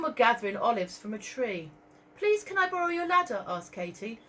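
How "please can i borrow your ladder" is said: put-on pleading girl. Katie voiced pleading.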